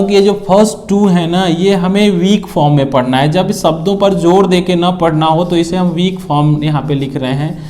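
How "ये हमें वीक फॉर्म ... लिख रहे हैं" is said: ordinary talk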